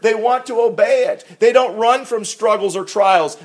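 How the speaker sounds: high, loud preaching build; fervent preaching. Acted. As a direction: lift it up like a pulpit climax. Preaching at full force.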